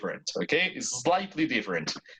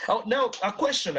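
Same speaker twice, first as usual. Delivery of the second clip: normal talking voice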